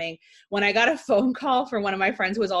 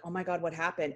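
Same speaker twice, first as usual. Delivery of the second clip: hushed question; 2 semitones lower, 8 dB quieter than usual. Retold or imitated. Classmate leans over whispering.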